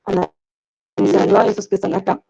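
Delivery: audio breaking up over a bad call; cutting in and out like a weak radio; choppy connection. Real, not acted. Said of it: "se escucha un poco in".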